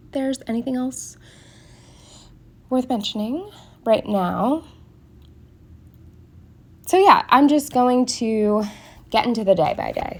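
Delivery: stuffy, blocked-nose voice; congested. Real, not acted. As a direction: talk through a congested nose. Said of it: "I'm talking so weird because I'm so congested".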